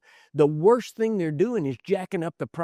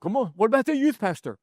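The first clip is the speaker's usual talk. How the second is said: insistent objection; 5 semitones higher, faster than usual. Retold or imitated. Church member to pastor, insistently demanding fairness. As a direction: push it harder, indignant. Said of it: "somebody's gonna say".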